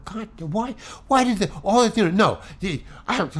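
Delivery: mock self-stoking indignation; retold exasperated ranting. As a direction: work yourself up, fumbling for words. Muttering rage walking to the meeting.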